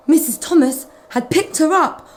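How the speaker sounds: plain reading voice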